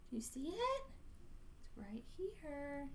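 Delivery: whispered, pointing it out; hushed aside. A narrator's reading. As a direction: whisper as if we might scare it. Narrator points out to listener.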